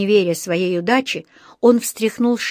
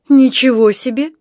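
loud, high indignant outburst; 4 semitones higher, 7 dB louder than usual. A narrator's reading. Husband blamed by his wife protests, indignant. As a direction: burst out in protest. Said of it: "возмутился мистер Браун".

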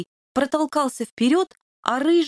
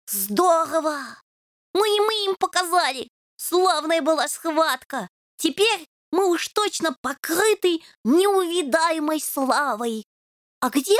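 excited kitten; 6 semitones higher than usual. A narrator's reading. Kitten boasting about victory.

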